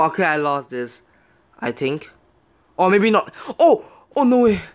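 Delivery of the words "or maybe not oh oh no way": loud, high bursts of surprise; real shock, voice leaping up; astonished. Real, not acted. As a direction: exclaim as hope suddenly returns. Sudden hope of winning.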